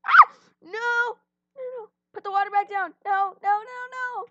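high, loud panicked pleading. Real, not acted. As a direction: shout no in panic. About to fall.

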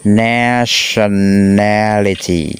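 "nationality" stretched very slowly, every syllable held. Shown as a demonstration. Teacher counts out a hard word on five fingers, very slow syllables. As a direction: draw out "nationality" one syllable at a time. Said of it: "cái từ này hay khó đọc".

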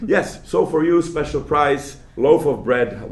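lightly hammed-up seller offering a special price; market seller. Lecturer plays a vendor quoting a tourist price, mock eager. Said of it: "there is this guy who says".

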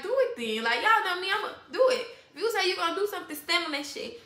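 defiant dare. Retold or imitated. Woman retelling how she called out a threat, defiant. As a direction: egg them on, pitch climbing. Retold dare during a standoff.

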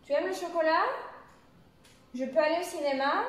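questions rising on CHOCOLAT and CINÉMA; interrogative intonation. Shown as a demonstration. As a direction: let "chocolat" and "cinéma" climb right up at the end. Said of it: "faire bien attention à ce type de".

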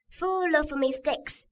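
"Full of" run together as one word, slow; slow "Full of mistakes", linked; linked reading. Shown as a demonstration. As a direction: glide "Full" straight into "of". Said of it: "在这里把full和of连读了".

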